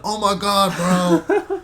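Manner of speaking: high, loud mock astonishment; shocked drunk bystander. Drunk bystander hears of a robbery, mock shock. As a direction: imitate a bystander's loud, appalled outburst.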